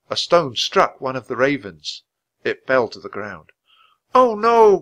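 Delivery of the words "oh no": high, loud dismayed cry. A narrator's reading. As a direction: cry out in dismay at the fallen bird. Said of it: "cried Annie".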